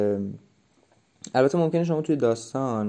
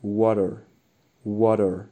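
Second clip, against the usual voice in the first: American water with a flapped d sound and r, louder; 5 dB louder, much slower than usual. Shown as a demonstration. Teacher modelling American water for students, louder.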